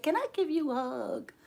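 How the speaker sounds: soft, high, sweet request; little old lady. Retold or imitated. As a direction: imitate an old lady asking gently.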